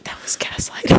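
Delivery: whispered tease, breath only; teasing whisper. Real, not acted. Teasing her friend about gaslighting, in a whisper. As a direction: hiss it softly as a playful jab.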